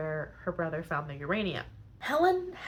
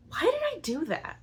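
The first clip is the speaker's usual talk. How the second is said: much higher, whiny voice of regret; regretful self-question; 12 semitones higher than usual. Retold or imitated. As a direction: whine it out like a man who regrets his choice. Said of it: "And he's like".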